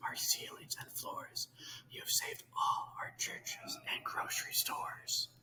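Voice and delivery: soft, breathy tiny-voice whisper; faint whisper. Acted. Tiny Who on the dust speck calling Horton, faint whisper. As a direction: whisper as if from far below. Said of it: "so faint he could just barely hear".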